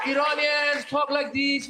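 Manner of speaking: slow drowsy drawl; slow Iranian talk. Shown as a demonstration. Comedian demos how slowly Iranians speak. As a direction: stretch each word out lazily. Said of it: "they talk a lot slower".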